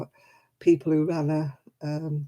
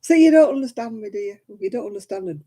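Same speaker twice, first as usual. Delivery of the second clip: fast, high, loud Lancashire dialect; playful Lancashire dialect tease; 4 semitones higher, 5 dB louder, faster than usual. Shown as a demonstration. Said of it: "It's what they call a dialect".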